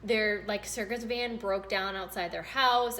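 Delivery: usual speaking voice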